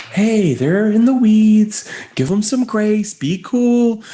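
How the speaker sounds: calm yourself down like a good angel; gentle angel urging patience; angel on shoulder. Retold or imitated. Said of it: "the angel on one shoulder".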